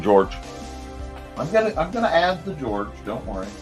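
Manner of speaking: ordinary delivery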